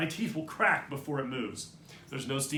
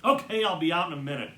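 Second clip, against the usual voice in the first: ordinary delivery, nothing marked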